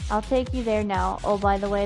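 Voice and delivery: ordinary talk, nothing marked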